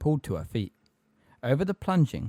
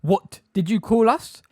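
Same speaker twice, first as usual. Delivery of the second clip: high, loud, slow outrage; outraged shout; 10 semitones higher, 6 dB louder, slower than usual. A narrator's reading. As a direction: shout it as a deliberate challenge.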